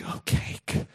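hushed, breath-only wonder; awed little son. Acted. Son hearing the word cake, hushed wonder. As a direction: whisper it like a child eyeing a treat. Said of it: "his son's like".

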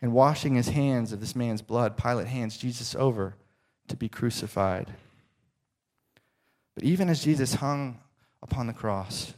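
usual speaking voice

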